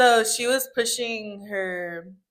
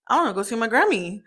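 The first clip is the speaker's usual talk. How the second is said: usual speaking voice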